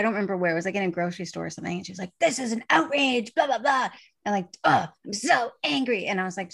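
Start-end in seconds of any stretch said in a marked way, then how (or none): 2.2-3.9 high-pitched mock outrage; put-on anger. Shopper making a scene in a grocery store, put-on anger. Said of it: "they act angry really easy". 4.6-6.0 hammed-up angry huff, pitched up; put-on anger. Shopper putting on anger in a store, not genuine. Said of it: "not as angry as she was acting".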